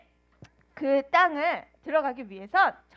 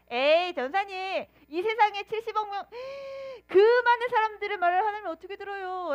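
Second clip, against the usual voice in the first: doubting objection; 5 semitones higher than usual. Pretend. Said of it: "라고 생각할 수 있을지".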